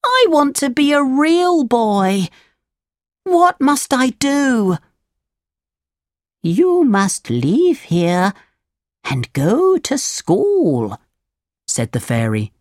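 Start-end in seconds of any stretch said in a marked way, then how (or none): 0.0-4.8 put-on high, drawn-out puppet boy plea; yearning little puppet, slow and high; Pinocchio, pleading. A narrator's reading. Pinocchio begs to become a real boy, high pleading voice. 6.4-11.0 slow, higher fairy voice; deliberate, stretched-out fairy advice; fairy, instructing. A narrator's reading. Fairy tells Pinocchio what to do, slow instructing voice.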